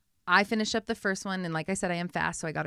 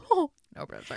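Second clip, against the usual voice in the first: sarcastic; 9 semitones higher, 3 dB louder than usual. Retold or imitated. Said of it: "So I'm like".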